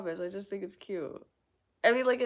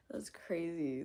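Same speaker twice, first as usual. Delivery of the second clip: ordinary talk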